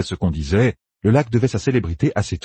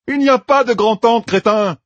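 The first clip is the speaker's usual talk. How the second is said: much higher, loud exasperated shout; exasperated cry; 5 dB louder than usual. A narrator's reading. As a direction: yell it at the dimwit. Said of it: "s'écria Matt".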